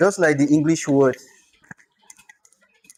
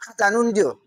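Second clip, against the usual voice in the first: mocking a Tiab tune, high then falling; imitated Tiab intonation; 7 semitones higher than usual. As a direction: imitate Tiab intonation on a borrowed word, pitched up. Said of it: "use Tiab intonation to pronounce it".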